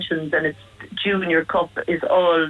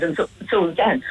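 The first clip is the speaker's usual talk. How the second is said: ordinary delivery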